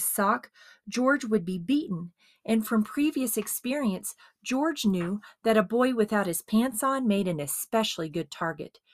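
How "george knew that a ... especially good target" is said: ordinary delivery, nothing marked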